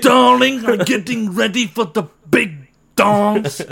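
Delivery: high, slow, put-on grand voice; hammed-up theatrical darling. Friend readies for the big dance, hammed-up darling voice. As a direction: play an affected darling, drawn out high. Mock-posh riff on dance.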